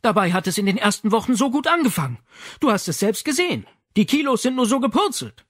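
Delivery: worried mother lamenting, fast and high; wringing hands. Acted. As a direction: plead it with hands wrung. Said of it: "Sie rang die Hände".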